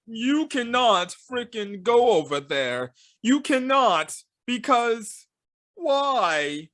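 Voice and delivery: lightly voiced officer blocking the way; stern Russian lady. Russian lady warning the trainers off, slow and insistent. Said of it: "this Russian lady is like".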